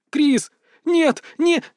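high, broken-off pleas; desperate cry. A narrator's reading. Berta protests as she is parted from Kris, high desperate cries. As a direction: cry out high in separate bursts, then break off.

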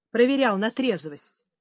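ordinary delivery, nothing marked

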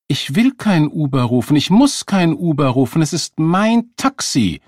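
loud, high, mounting indignation; angry insistence. A narrator's reading. Woman refusing to give up her taxi, furious. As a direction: hammer each refusal, pitched high.

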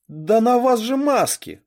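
high, loud, drawn-out indignation; indignant. A narrator's reading. Girl protests to the masked intruders, indignant. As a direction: protest slowly and loudly, outraged. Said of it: "возмутилась я".

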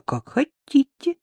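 высоко и медленно воркуя; нарочито ласково воркуя; проворковала. Acted. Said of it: "проворковала Рита". (Рита воркует свекрови по телефону)

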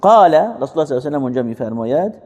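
ordinary talk

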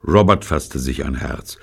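usual speaking voice

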